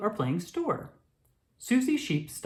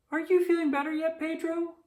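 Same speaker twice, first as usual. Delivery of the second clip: high sheep voice; 13 semitones higher than usual. Acted. Nurse Susie voiced.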